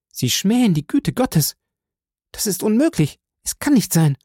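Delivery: high, pleading voice rising into horror; distressed young woman. A narrator's reading. Valentin begs the count to leave, horrified. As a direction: push him away in disbelief.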